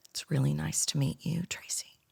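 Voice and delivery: imitating a shy client, sinking to a whisper; hushed, barely-there acted greeting; timid greeting. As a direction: trail off into a whisper.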